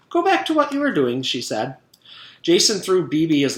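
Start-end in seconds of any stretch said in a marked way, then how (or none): 0.1-1.2 high, sugary, falsely sweet. A narrator's reading. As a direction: switch back to sweet teacher after the outburst. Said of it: "She smiled sweetly".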